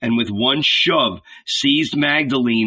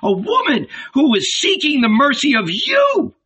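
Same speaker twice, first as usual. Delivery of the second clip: much higher, indignant berating; angry scolding; 11 semitones higher than usual. A narrator's reading. Old Salome berates her husband, indignant. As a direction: tear into him for his cowardice.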